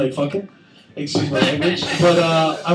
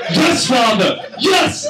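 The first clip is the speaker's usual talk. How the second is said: ecstatic cry; 6 semitones higher, much slower than usual. Retold or imitated. Son answering God at climax, high ecstatic shout. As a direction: cry it out in rapture.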